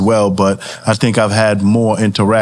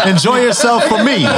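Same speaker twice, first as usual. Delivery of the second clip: laughing; 7 semitones higher than usual. Real, not acted. Laughs while joking.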